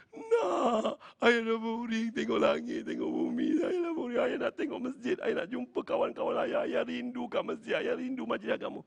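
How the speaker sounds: high-pitched, acted sobbing plea; tearful wailing. Bedridden father begs son to go to mosque, wailing. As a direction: cry it out as a longing old father. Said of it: "Ayah dia terus menangis".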